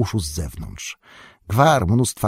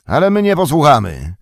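loud, higher orc shout of defiance; defiant call; 7 semitones higher, 7 dB louder, faster than usual. A narrator's reading. Northern orc refusing Ugluk's command, shouting. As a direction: shout down the order. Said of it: "zawołał ten".

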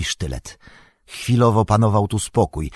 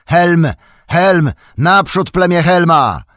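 high, loud rallying shout; battle cry; 9 semitones higher, 7 dB louder, slower than usual. A narrator's reading. Old warrior rallying Helm's people to charge, shouting. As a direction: roar the troops forward. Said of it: "z tym okrzykiem".

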